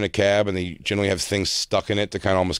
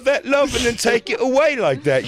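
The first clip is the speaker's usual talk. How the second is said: whiny lover voice; 11 semitones higher than usual. Acted. Mock heartbroken boyfriend.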